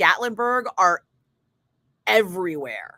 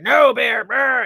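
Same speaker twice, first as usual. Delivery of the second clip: guard shooing bear; 4 semitones higher, 7 dB louder, slower than usual. Retold or imitated. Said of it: "security guard with them who was like".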